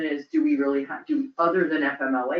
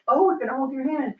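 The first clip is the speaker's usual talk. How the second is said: lightly acted good cop, pitched up; a higher, mildly reassuring mock voice; 8 semitones higher than usual. Voicing the performance improvement policy as a mild good cop. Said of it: "Good cop, bad cop".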